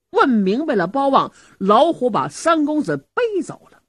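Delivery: ordinary delivery, nothing marked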